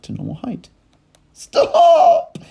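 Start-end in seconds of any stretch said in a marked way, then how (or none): 1.3-2.4 high, loud cry; cry of stop. A narrator's reading. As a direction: fling the word out high and loud. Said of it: "cried Missus Cranky".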